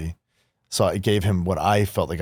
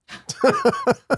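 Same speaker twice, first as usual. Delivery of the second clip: surprised high laugh. Real, not acted. Dad says he's proud.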